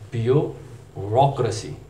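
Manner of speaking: ब्यूरोक्रेसी slowly as byoo-ROCK-ruh-see; slow it down, rounded "rock" in ब्यूरोक्रेसी; British pronunciation. Shown as a demonstration. Teacher modelling the British form, slow byoo-ROCK-ruh-see for ब्यूरोक्रेसी. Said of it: "British pronunciation इसकी है".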